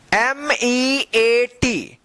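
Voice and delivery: एम ई ए टी drawn out letter by letter; slow spelling. Shown as a demonstration. Teacher spells for learners, एम ई ए टी stretched slowly. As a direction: spell एम ई ए टी with long letter names.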